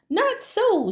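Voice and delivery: high squeaky worm voice; a light sighing worm; mock sigh. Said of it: "sighed the worm".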